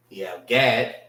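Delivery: normal talking voice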